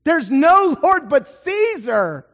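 loud, high, emphatic rebuttal; scornful Roman retort. Acted. Roman rejecting Jesus as Lord, loud and scornful. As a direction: thunder back as an outraged Roman. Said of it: "The Romans would reply with".

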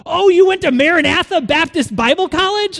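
very high, loud mock astonishment; hammed-up, high-pitched public exclamation; loud announcing. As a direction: blurt it out so everyone nearby hears. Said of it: "Like that".

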